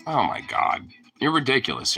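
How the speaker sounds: ordinary talk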